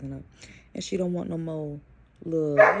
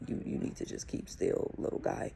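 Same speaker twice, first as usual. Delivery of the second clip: whispered warning; 7 semitones lower, 6 dB quieter than usual. Retold or imitated. Mom warning toddler on plane.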